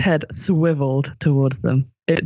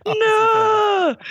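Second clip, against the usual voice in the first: anguished Northern scream. Acted. Doctor cradling the charred skeleton, screaming. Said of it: "screamed the Doctor".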